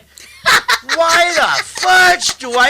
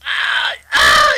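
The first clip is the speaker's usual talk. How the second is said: pained cry; 5 dB louder than usual. Retold or imitated. Toe being cut, it hurts.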